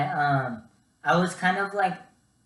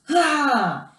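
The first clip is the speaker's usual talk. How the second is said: imitated scream; 10 semitones higher, 7 dB louder than usual. Retelling the painful pull.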